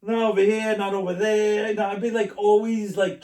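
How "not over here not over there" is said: loud, higher-pitched Brooklyn guy; raised-voice Brooklyn street talk; Brooklyn accent. Acted. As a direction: put on a showy Brooklyn accent. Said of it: "being that Brooklyn guy".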